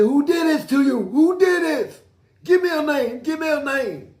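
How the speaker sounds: loud, very high, insistent demand; shouted interrogation. Retold or imitated. Guard demanding the attacker's name from victim, shouted interrogation. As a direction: yell each question, high and stretched out. Guard questions the injured man.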